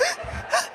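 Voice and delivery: 1 sharp gasp. Retold or imitated. Realizing she overreacted.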